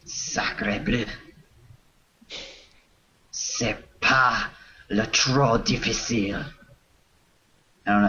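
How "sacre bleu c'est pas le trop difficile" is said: hammed-up angry French accent; put-on French accent, annoyed. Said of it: "doing angry French".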